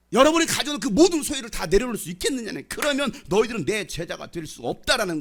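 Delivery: loud, high, driving sermon delivery; fervent preaching. Acted. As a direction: press the challenge on the congregation. Preaching at full force. (힘있게 외치는 설교조)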